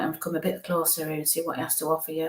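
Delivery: ordinary delivery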